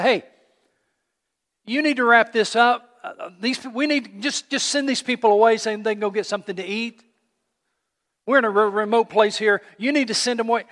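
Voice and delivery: hammed-up impatient demand to wrap it up; pushy demand. Disciples pushing Jesus to act.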